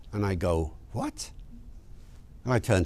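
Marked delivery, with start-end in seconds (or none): 0.9-1.3 soft, high-rising what; small, puzzled squeak of disbelief; quietly taken aback, voice shooting up; baffled. Retold or imitated. Baffled reaction to road sign.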